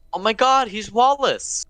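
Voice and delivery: high-pitched shocked exclamation; mock astonishment. Real, not acted. Interrupting co-host's impression, mock astonished.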